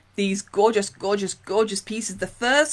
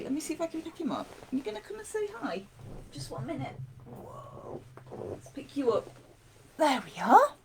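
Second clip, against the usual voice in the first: talking to a dog; 6 semitones higher, 6 dB quieter than usual. Real, not acted. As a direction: coo at the dog, fast and light.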